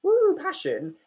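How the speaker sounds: high, loud mock cheer; hammed-up woo of empty passion; over-the-top passion. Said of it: "if you're just like".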